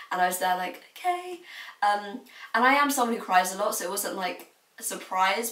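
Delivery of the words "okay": high, small retold "okay"; meek okay. Tearful student answering her head of year, high and meek. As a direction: accept it meekly in a raised pitch. Meek reply to teacher after crying.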